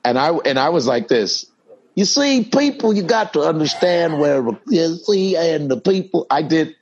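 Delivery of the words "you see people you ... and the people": high, sing-song Cosby voice; high mock Cosby lecture; Cosby impression. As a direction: lecture the crowd as Bill Cosby. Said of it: "doing Cosby".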